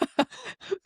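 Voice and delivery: high burst of laughter; laughing. Real, not acted. Hosts laughing at sign-off.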